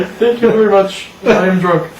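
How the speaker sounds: mock toast sign-off; drunken toast. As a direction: imitate a tipsy speaker closing a toast. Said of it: "it was just about like that".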